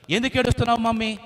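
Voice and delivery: put-on high, quick childlike chick voice; little chick. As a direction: ask mummy like a small child. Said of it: "అడిగిందనమాట పిల్ల పక్షి".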